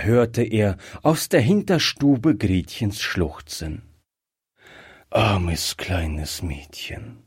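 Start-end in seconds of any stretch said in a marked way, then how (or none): 5.1-7.2 slow, low, whispery pity; hushed, regretful inner thought; thinking to himself. A narrator's reading. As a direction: murmur it slowly under the breath. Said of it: "dachte Griffier".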